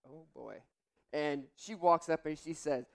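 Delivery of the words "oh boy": dread. Retold or imitated. Dread as lady charges.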